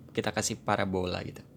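ordinary delivery

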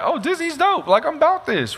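high breezy delight. Retold or imitated. To himself in the Disney line, high breezy delight. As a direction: float it up high, carefree and happy. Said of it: "keeping that same energy".

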